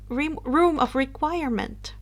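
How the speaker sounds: ordinary delivery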